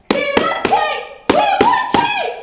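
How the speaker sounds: very high, loud chant, the last words slowed; mob yelling. Acted. The starving crowd yelling at the palace for cake. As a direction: scream it like an angry crowd. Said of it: "So they yelled".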